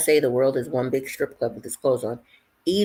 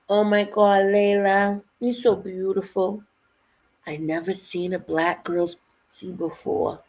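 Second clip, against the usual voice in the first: higher-pitched imitation of a Russian accent; Anna's Russian accent; 5 semitones higher than usual. Retelling a Russian coworker's amazement at her, in imitated Russian accent. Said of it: "with her accent, she would say".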